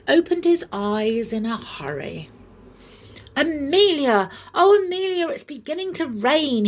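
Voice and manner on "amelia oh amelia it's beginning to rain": high, loud, excited calling; glad cry. A narrator's reading. Bear calls Amelia as he feels drops, gladly excited. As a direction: cry out with delight. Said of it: "he cried gladly".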